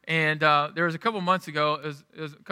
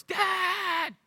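put-on high, drawn-out call of a small child; imitated child's yell. Son calling dad from bed, mock yelling. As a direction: mimic a kid hollering for dad, pitched way up without much volume. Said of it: "He's just yelling his head off".

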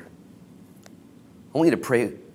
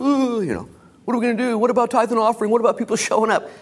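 mock worry; 12 semitones higher, 4 dB louder, faster than usual. Mocks a worried churchgoer.

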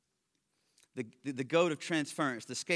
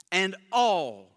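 "all" lifted and louder than the rest; stress on all; 4 semitones higher, 7 dB louder than usual. Shown as a demonstration. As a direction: lean on "all", then pause. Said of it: "I tried to stress the word all here".

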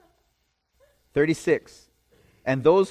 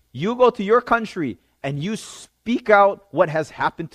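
loud, higher, emphatic command; commanding quote; 7 semitones higher, 7 dB louder than usual. Acted. Jesus tells the man to declare it, raised commanding voice. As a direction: charge him forcefully to go.

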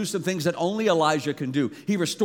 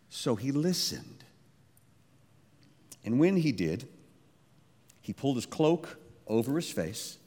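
usual speaking voice

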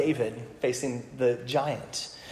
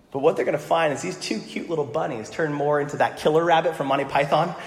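usual speaking voice

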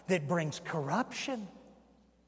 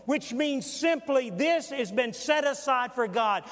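loud, higher-pitched pulpit voice; forceful preaching; 5 semitones higher, 4 dB louder than usual. Acted. Preacher scorning excuses made to parents for the temple, forceful preaching. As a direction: drive it home to the congregation, loud and high.

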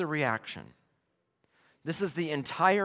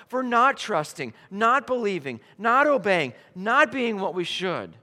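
louder and higher, driving sermon; full-force preaching; 6 semitones higher, 6 dB louder than usual. Acted. Preacher warning the congregation of judgment, impassioned.

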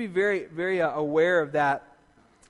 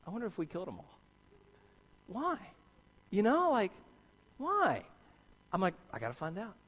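hushed musing; 8 dB quieter than usual. Retold or imitated. Musing alone at desk.

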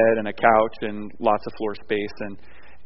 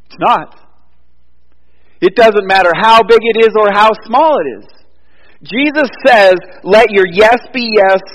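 preaching at full force; 11 semitones higher, 11 dB louder than usual. Acted. Sermon climax quoting Jesus.

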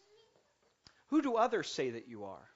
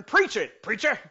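much higher, loud call-out; Baptist preacher; 7 semitones higher, 7 dB louder than usual. Retold or imitated. Preacher quoting himself going Baptist, loud call. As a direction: call it out like a Baptist amen corner. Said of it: "when I go Baptist".

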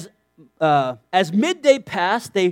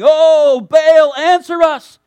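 loud pleading cry to Baal; calling out; 8 semitones higher, 9 dB louder, much slower than usual. Retold or imitated. Preacher voicing Baal's prophets, loud desperate calling. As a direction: call out desperately to a god.

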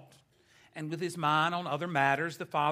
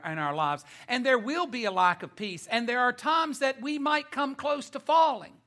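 emphatic, building pulpit cadence; forceful preaching; 6 semitones higher, 7 dB louder than usual. Acted. Preacher warns congregation of coming troubles, forceful preaching. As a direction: drive each repeated warning home.

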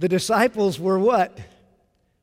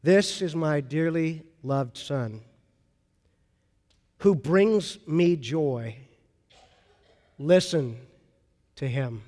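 lower, slow and solemn; God declares his son to the disciples, solemn and low; 5 semitones lower, 3 dB quieter, slower than usual. Retold or imitated. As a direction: speak as the voice from the cloud. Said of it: "a voice from the cloud says".

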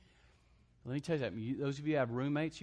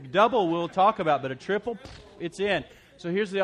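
louder, higher, laughing triple dog dare; full-force preaching; 4 semitones higher, 10 dB louder than usual. Acted. Preacher daring the congregation to check their words, rousing.

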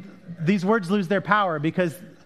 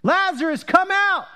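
high, commanding shout; loud cry; 12 semitones higher, 5 dB louder than usual. A narrator's reading. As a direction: call out with full voice to summon someone from a tomb. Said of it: "he cried with a loud voice".

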